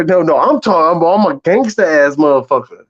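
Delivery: ordinary talk, nothing marked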